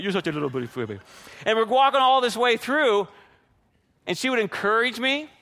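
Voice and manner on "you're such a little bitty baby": put-on low, soft baby talk; talking to a dog. Shown as a demonstration. Man fussing over his dog, soft doting baby talk. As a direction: coo quietly as if to a pet. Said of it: "the way I talk to my dog sometimes".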